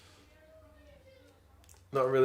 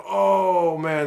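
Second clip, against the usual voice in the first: startled oh; 7 semitones higher, 8 dB louder than usual. Real, not acted. Near intercept in the match.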